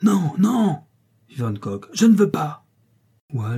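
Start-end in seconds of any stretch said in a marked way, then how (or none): 0.0-0.8 high, forceful refusal; protesting cry. A narrator's reading. Van Kock refuses in alarm. 1.9-2.7 high, loud refusal; protesting cry. A narrator's reading. Van Kock refuses in alarm.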